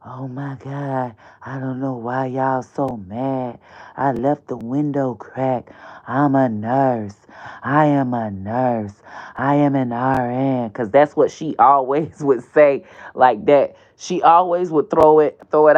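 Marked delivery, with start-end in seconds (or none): 0.0-10.7 acting like Brittany. Host plays Brittany defending herself on his show, softly. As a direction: play her protesting innocence, low and soft. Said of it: "I was acting like her".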